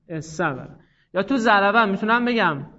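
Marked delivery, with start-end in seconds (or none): 0.1-0.8 استبره said as essabbara, s doubled; assimilated form. Shown as a demonstration. Teacher demonstrating the merged form to students, doubled s. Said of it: "احسنتون".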